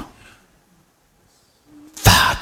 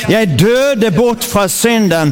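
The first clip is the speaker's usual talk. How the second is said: raised, proclaiming preacher's voice, line by line; preaching at full force; 9 semitones higher, much slower than usual. Acted. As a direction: declare each line like a battle cry for the church to echo. Leads congregation in declaring scripture.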